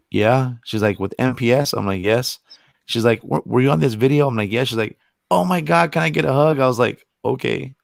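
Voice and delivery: ordinary delivery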